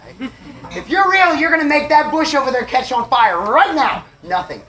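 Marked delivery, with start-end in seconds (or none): 0.8-4.0 high, loud retold demand; yelling at the sky. Man demanding a sign from higher power, acted-out yelling. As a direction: holler a challenge up at the heavens. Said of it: "I'm yelling at the sky".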